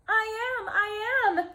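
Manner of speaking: high, loud cry; shout. Acted. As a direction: shout it so they hear. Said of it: "Sylvester wanted to shout".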